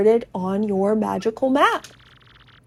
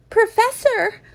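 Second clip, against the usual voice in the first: put-on much higher, alarmed girl; Goombella calling out; 12 semitones higher than usual. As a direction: call out to the professor, worried. Goombella calls to the professor.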